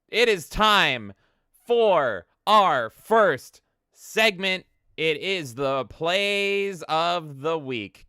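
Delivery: high, loud and very slow segment intro; announcer voice. Acted. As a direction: announce the segment like a show host. Announces first show segment.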